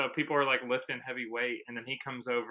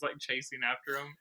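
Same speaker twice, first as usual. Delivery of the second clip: normal talking voice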